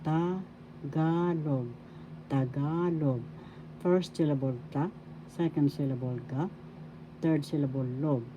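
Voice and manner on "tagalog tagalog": Tagalog said slowly, with GA drawn out; pronunciation demo. Shown as a demonstration. Teacher shows learners how to pronounce Tagalog, GA drawn out. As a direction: stretch the middle of Tagalog: ta-GAA-log. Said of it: "you know how to pronounce Tagalog".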